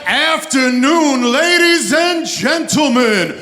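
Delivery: booming drawn-out ring announcer; big arena welcome, stretch it out. Real, not acted. Announcer opens the show.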